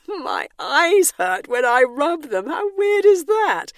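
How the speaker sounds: high, slow dreamy king voice; drowsy king. Acted. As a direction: babble like a half-asleep king.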